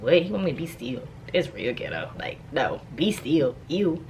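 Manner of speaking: low, soft disgruntled mumble; low grumbling mutter. Retold or imitated. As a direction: grumble it under your breath, put out. Grumbling at God's instruction.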